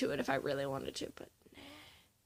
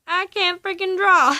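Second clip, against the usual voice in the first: frustrated outburst; 5 semitones higher, 5 dB louder than usual. Real, not acted.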